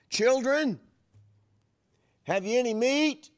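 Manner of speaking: high, loud holler from the shore; calling across distance. Acted. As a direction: lift the voice to carry over water. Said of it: "he had to lift up his voice".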